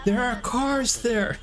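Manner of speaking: imitating the dad yelling; mock angry yell. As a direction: bark it like a fed-up dad in traffic. Voicing the angry father in the clip.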